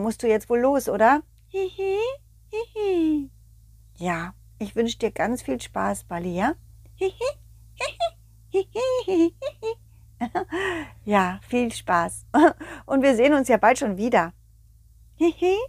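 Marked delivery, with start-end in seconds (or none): 1.5-3.3 tiny high-pitched giggle. Acted. Ball's giggle voice. 7.0-9.3 tiny high-pitched giggle. Acted. Ball's giggle voice. 15.1-15.6 tiny high-pitched giggle. Acted. Ball's giggle voice.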